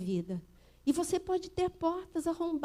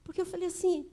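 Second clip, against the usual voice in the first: ordinary talk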